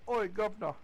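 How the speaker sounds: lightly voiced cockney British accent; a half-hearted cockney British "Oi". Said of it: "the only thing I can think of is".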